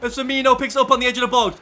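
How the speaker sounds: loud, rising TV commentator calling the final minutes; live match commentary. Pretend. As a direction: call it like a cup final on telly. Commentating his own match.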